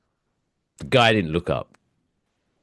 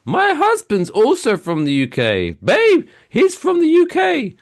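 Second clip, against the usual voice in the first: much higher, louder, slower gushing squeal; excited squeal; 5 dB louder, slower than usual. Retold or imitated. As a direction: squeal it like she just found a fellow Brit. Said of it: "And she's like".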